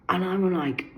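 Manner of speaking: ordinary talk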